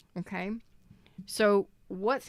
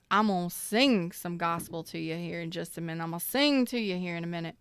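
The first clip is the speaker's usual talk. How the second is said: ordinary talk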